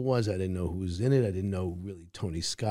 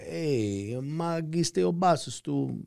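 put-on slow, higher Italian street accent; Italian tough guy; 7 semitones higher, slower than usual. Actor quoting his pilot line, Italian tough-guy voice. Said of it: "where I had to say".

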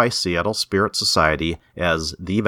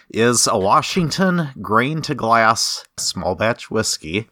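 normal talking voice

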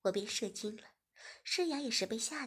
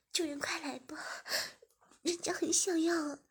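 higher, eager begging; pleading young woman; 6 semitones higher than usual. Acted. Shiya urging her master, higher. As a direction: urge him up high.

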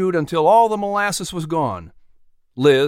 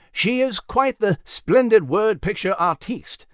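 lightly higher character voice for a refined speaker; Nigel; 6 semitones higher than usual. A narrator's reading. Nigel agreeing with Liz, lightly put-on character voice. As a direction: put on a slightly theatrical, raised voice.

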